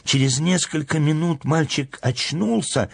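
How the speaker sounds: ordinary delivery, nothing marked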